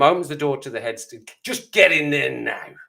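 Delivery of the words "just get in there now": slow, drawn-out mock yell; screamed order. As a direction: order the boy in with stretched-out fury. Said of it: "just absolutely screams at him".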